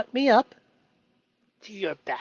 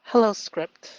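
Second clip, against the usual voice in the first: usual speaking voice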